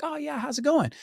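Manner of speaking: bright phone voice. Retold or imitated. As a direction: answer the call brightly. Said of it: "I was like".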